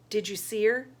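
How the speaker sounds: eager, rising question; excited question. A narrator's reading. Little sister asking about the body, excited. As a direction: ask it breathlessly eager. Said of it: "she asked excitedly".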